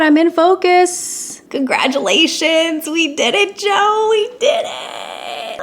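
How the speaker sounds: drawn-out, rising cry of triumph; excited, stretched-out victory cheer; celebrating. Real, not acted. Celebrates the test shot.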